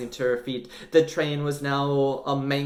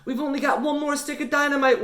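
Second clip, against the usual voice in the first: warning; 13 semitones higher, faster than usual. Acted. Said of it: "warned Gabbs".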